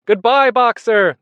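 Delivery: high, loud, drawn-out goodbye; chorused farewell call. A narrator's reading. Animals seeing Boxer off, calling out loudly. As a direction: call it out slowly like a crowd. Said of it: "they chorused".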